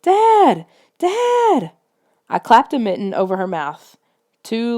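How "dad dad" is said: very high, loud screams; screaming. A narrator's reading. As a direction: scream at the top of your lungs. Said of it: "screamed at the top of her lungs".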